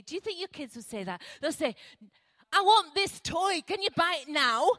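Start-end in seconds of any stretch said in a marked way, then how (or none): 2.5-4.7 put-on loud, high, bossy kid demanding a toy; a child who wants it right now, louder. Child demanding a toy from their parent, loud and high.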